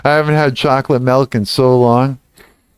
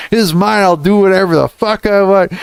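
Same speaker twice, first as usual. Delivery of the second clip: higher defiant claim; hammed-up possessive rant; mock defiance; 8 semitones higher than usual. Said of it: "I was like".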